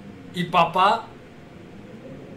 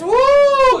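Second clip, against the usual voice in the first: loud high-pitched whoop; 9 dB louder than usual. Real, not acted. Thanking his donors, a loud high cheering whoop.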